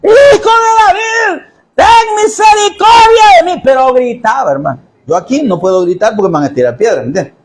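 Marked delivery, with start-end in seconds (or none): 0.0-3.6 a blind beggar crying out over the crowd; desperate shout. Retold or imitated. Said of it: "él gritaba".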